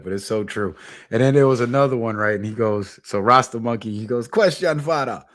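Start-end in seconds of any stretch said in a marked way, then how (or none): 4.3-5.2 imitating a Jamaican host, pitched up; Jamaican accent. Host monkey addresses his elder, imitated Jamaican accent. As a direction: put on a playful Jamaican accent. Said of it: "I love how they talk".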